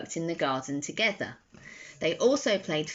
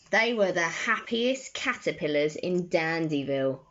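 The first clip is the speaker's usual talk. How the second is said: usual speaking voice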